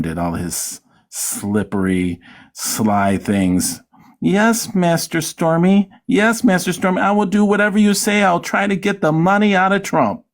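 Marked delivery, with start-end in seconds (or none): 4.2-10.2 high, fawning mock servant voice; servile lackey. Mocking Cohen as Stormy's groveling servant, high and fawning. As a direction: grovel like a lackey to his master.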